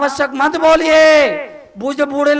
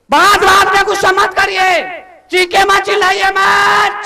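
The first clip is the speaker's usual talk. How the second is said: preacher's shout; 6 semitones higher than usual. Real, not acted. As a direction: thunder it at the crowd. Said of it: "चिल्लाने के लिए तरुण सागर बहुत है".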